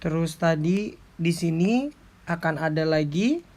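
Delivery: usual speaking voice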